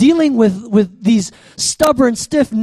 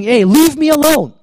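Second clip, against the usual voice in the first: higher, raised-voice rebuke; imitated angry God; 6 semitones higher, 4 dB louder than usual. God snaps at Moses in the preacher's retelling, forceful. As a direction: act out a forceful command to back off.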